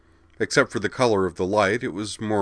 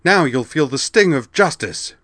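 higher, louder furious threat; angry shout; 8 semitones higher, 7 dB louder than usual. A narrator's reading. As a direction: hurl the threat out in fury. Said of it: "snarled the Valkyrie".

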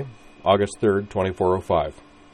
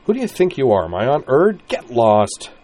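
much louder, higher furious shout; angry snap; 6 semitones higher, 7 dB louder than usual. Acted. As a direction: shove her off and yell. Corwin continues angry outburst.

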